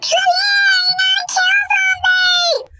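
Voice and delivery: high, panicked shriek; shrieking friend. Retold or imitated. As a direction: shriek it like a terrified girlfriend. Friend screaming on phone.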